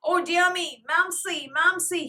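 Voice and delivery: loud, high blubbering cry. A narrator's reading. Boy blubbering for Mamsie in a tantrum. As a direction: wail for his mother. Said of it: "he blubbered".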